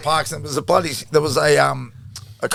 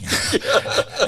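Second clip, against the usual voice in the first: laugh; 11 semitones higher than usual. Real, not acted. Laughing about freezing on sideline.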